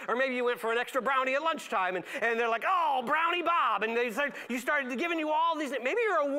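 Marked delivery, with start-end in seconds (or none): 2.7-3.8 higher, slow teasing nickname call; sing-song jeer at the kid; mocking taunt.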